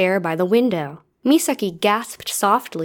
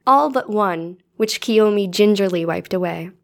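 usual speaking voice

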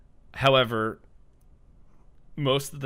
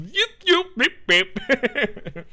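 yipping; 14 semitones higher, 6 dB louder, slower than usual. Retold or imitated. Ghost yipping for help.

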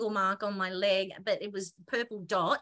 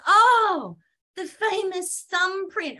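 knowing exclamation; 9 semitones higher, 8 dB louder, much slower than usual. Retold or imitated. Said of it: "he goes".

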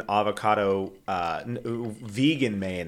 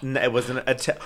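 ordinary delivery

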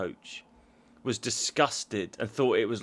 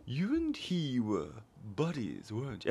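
Matt Berry line; 9 dB quieter than usual. Retold or imitated. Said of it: "he says to Rick Douglas".

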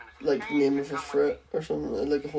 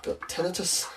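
whispered; 9 dB quieter than usual. Acted. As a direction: whisper "You've got tinnitus" with no voice at all. Teasing friend about ear ringing.

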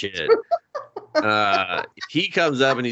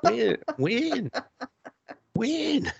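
escalating incredulous when; 12 semitones higher, 4 dB quieter, much slower than usual. Retold or imitated. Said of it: "I'm like".

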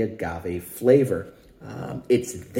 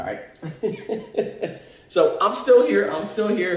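laughing; 9 semitones higher, slower than usual. Real, not acted. Laughs while off camera.

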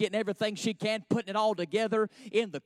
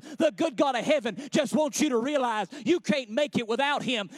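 louder, higher preaching building to a sung shout; full-force preaching; 5 semitones higher, 6 dB louder than usual. Acted. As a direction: preach it up till it rings.

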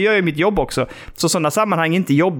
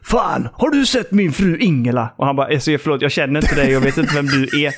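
weird stranger. Retold or imitated. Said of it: "En riktig jävla kuf".

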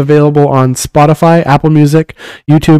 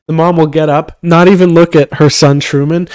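usual speaking voice

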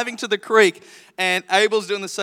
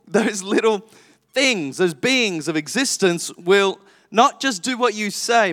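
ordinary talk

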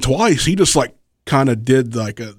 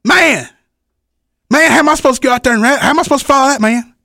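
high, fast anxious whine; worried A J; 12 semitones higher, 3 dB louder, much faster than usual. Retold or imitated. Wrestler imitating the next act panicking about following them. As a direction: rattle it off in a panic. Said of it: "and he's like".

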